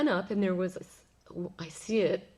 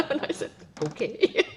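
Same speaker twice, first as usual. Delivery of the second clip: words through real laughter; laughing; 5 semitones higher, 4 dB louder than usual. Real, not acted. Conceding to the boy while laughing, real laugh. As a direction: let the laugh spill into the words. Still laughing after boy's remark.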